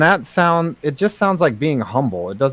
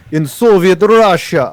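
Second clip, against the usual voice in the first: put-on loud warm-up Russian accent; 2 semitones higher, 5 dB louder than usual. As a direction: launch into a Russian accent, loudly. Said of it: "since I've done a Russian accent".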